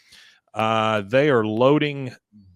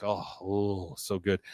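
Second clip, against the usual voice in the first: soft delight; 5 semitones lower, 8 dB quieter, slower than usual. Retold or imitated. Peggy tasting steak.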